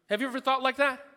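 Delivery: ordinary delivery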